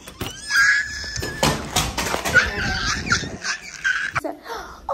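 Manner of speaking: huge high gasp of delight, half laugh; excited gasp. Real, not acted. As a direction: gasp out loud at the surprise gift. Sees gift basket at new apartment.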